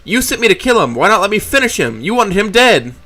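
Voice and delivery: raising her voice at the boss. A narrator's reading. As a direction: push the volume up as you accuse. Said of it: "my voice raises in volume".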